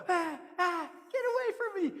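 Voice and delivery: high fussy tree voice; shooing tree. Acted. The tree pushes Coyote away, high-pitched. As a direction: brush him off, high and fussy.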